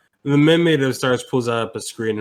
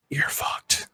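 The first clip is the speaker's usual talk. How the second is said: sinister whisper; 6 dB quieter than usual. Acted. Inner voice answers.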